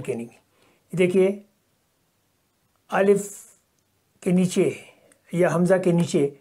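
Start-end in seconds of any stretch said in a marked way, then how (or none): none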